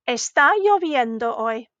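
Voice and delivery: "Está lloviendo hoy" told, not asked; statement intonation. Shown as a demonstration. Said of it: "that one was not a question".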